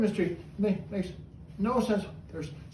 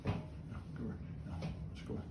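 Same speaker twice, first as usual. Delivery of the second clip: much softer, whispered aside; whispered correction; 11 semitones lower, 16 dB quieter, much faster than usual. Real, not acted. Quiet correction of bird.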